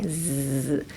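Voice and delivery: a soft buzzing Z sound. Shown as a demonstration. Teacher demonstrates the Z sound. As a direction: buzz the Z on your teeth. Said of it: "Zですね。".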